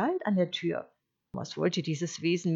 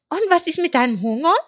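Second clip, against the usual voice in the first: high, loud, quick sheep question; naive sheep; 11 semitones higher, 12 dB louder, faster than usual. Acted. Sheep asking about the wolf's hunger, quick bleat. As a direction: blurt it out high and fast.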